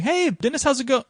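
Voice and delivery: lightly voiced neighbor's greeting, pitched high; passing neighbor. Neighbor Jill greeting Dennis as she jogs by, lightly imitated. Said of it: "She jogs by going".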